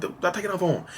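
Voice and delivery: high mock yell at reduced volume; imitated yelling. Retelling the fight with wife and kids, mock yelling. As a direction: replay the row in a high, held-back yell. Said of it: "I'm yelling and screaming".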